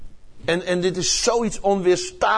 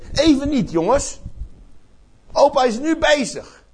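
put-on busy grandpa brushing kids off; 8 semitones higher than usual. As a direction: wave the kids away mid-call.

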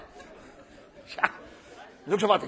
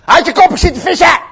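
high, loud irritated yell; hammed-up cranky shout; grumpy outburst; 11 semitones higher, 19 dB louder than usual. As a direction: bite back at whoever is disturbing you. Grumpy Peter snaps at John.

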